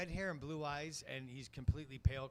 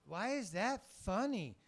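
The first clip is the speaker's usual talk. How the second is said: put-on higher slow puzzled child voice; slow, confused little boy, higher; puzzled kid voice; 7 semitones higher, 3 dB louder, much slower than usual. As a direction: nephew not getting the joke, slow high kid voice. Nephew voiced in story.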